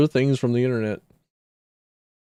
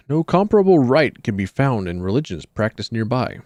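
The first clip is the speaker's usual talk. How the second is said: lower, measured document read; lore reading; 5 semitones lower than usual. Acted. Streamer reading cult lore to viewers, measured and low. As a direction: read it like an old dark chronicle.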